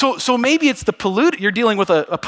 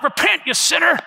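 mock hellfire preacher; condemning preacher; 8 semitones higher than usual. Shown as a demonstration. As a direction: shout it down at a sinner. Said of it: "Not like that".

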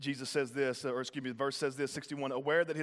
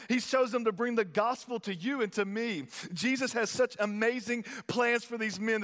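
higher, louder preaching, building up; full-force preaching; 7 semitones higher, 4 dB louder than usual. Acted. Preacher firing up the congregation about disciples, full-force preaching. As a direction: preach it out to the crowd.

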